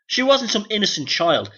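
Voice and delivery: ordinary delivery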